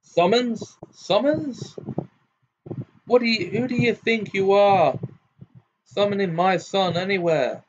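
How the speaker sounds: high, incredulous outburst; indignant high-pitched fury; outraged. A narrator's reading. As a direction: repeat it in rising disbelief, then rail. Gaunt outraged at the summons.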